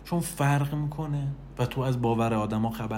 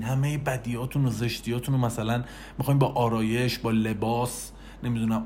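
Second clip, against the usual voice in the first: ordinary delivery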